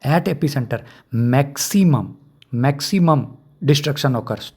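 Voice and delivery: very slow, each word drawn out for notes; slow dictation. Shown as a demonstration. Teacher dictating the exam line to students, very slow. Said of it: "ध्यान से सुनिए".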